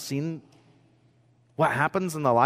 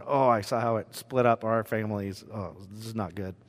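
quiet dismay; 4 semitones lower, 5 dB quieter than usual. Retold or imitated. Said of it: "just going".

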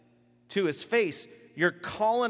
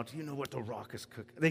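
quiet, low wrestler taunt; Rock catchphrase; 3 semitones lower, 7 dB quieter than usual. Retold or imitated. Preacher quoting the Rock's catchphrase, low and soft. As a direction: growl the catchphrase like a pro wrestler. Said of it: "He did other motions I won't do".

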